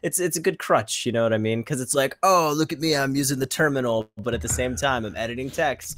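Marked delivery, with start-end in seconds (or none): 2.2-4.1 lightly mocking boast, pitched a little up; mock show-off. Mocking Nano users feeling clever, mild show-off voice. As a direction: play a hint of a proud beginner showing off. Mocking a proud beginner terminal user.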